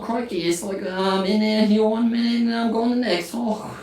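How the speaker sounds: high, put-on British accent. Showing friends her best accent, hammed-up British accent. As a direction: show off a cartoon British voice. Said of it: "my British is spot on".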